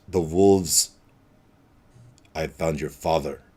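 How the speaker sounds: low, quiet and menacing lord's voice; Strahd. Acted. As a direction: purr it out like a vampire lord who holds all the cards. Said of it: "And, and Strahd says".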